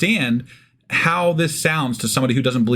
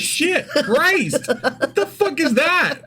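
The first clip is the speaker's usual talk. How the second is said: much higher, startled bystander; mock shock; 14 semitones higher, much slower than usual. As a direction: squeal it like a freaked-out passerby.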